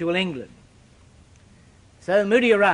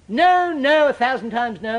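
loud, high opening no's, easing off after; imitated emphatic refusal; 5 semitones higher, 4 dB louder than usual. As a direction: mimic a preacher's booming catchphrase. Repeats Moody's emphatic catchphrase.

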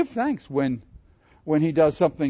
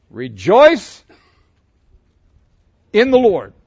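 loud preaching proclamation, much louder; proclaimed verse; 6 semitones higher, 13 dB louder, slower than usual. Acted. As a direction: call the verse out to the room.

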